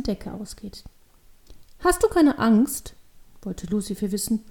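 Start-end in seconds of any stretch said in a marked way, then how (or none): 1.8-2.9 put-on high, slow child voice; curious little girl. A narrator's reading. Devil girl asks the stranger about fear, curious child. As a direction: ask slowly like a wondering child.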